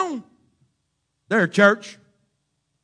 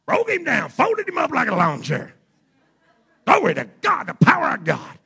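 high, loud mock boasting; mock gloating churchgoer; 9 semitones higher, 6 dB louder than usual. Pastor lampooning churchgoers, high mock gloating. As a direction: crow like a smug saint. Continuing the smug church mockery.

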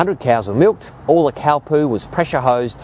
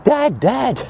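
high, urgent retold call for Dad; retold scream; 11 semitones higher than usual. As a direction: replay a small boy yelling for his father. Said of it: "I'm screaming".

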